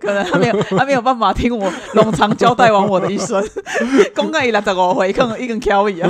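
laughing loudly through a teasing joke; laughing while talking. Real, not acted. Joking the hypnotist got tired.

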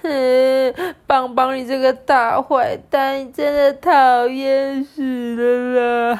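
high, loud, slow whiny sobbing complaint; a little dinosaur girl wailing at her friend; Lala crying complaint. Acted. Lala blaming Bangbang through nasal sobs. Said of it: "又开始哭".

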